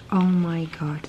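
ordinary delivery

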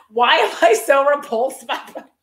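laughing while asking; real laughing. Real, not acted. Laughing at how repulsed she is by Brody. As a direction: giggle through the question. Laughing at her own disgust.

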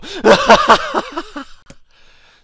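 very loud, high maniacal laugh; manic cackle. A narrator's reading. Apothecary laughing at his victim's horror, loud manic cackle. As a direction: burst into shrieking villain laughter.

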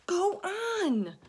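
high, loud, crying; frantic. A narrator's reading. Travellers frantically urging James. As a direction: plead frantically. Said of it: "they said frantically".

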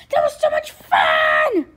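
high, loud and slow with glee; thrilled shout. Acted. Koopa landing after his jump, thrilled shout.

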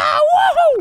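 loud high woohoo; excited cheer. Real, not acted. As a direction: whoop with joy. Slide race start.

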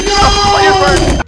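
long, high, loud wail; one sustained anguished cry; screamed protest. Real, not acted. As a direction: scream it out in dismay. Game setback.

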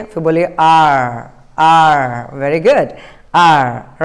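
"Are" with the tongue curled back on the r; teacher models the American r in "Are". Shown as a demonstration. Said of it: "जीभ को ऐसा मोड़िए".

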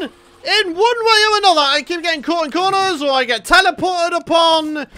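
high-pitched exasperated complaint; losing patience. Real, not acted. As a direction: gripe as patience runs out. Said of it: "my patience is wearing very thin".

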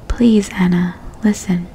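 plain reading voice

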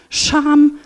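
ordinary talk, nothing marked

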